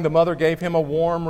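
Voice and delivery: normal talking voice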